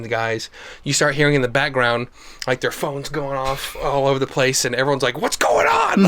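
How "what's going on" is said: high panicked mock yell; mock screaming. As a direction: shriek it like a whole call center panicking. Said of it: "like, screaming".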